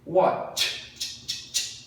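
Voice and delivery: "Watch" with the final ch repeated voiceless; unvoiced ending. Shown as a demonstration. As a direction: isolate the "ch" of "Watch" as pure air. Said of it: "Não tem voz". ("Watch" com o ch final repetido, sem voz)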